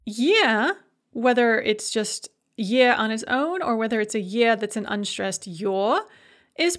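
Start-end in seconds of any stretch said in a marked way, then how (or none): none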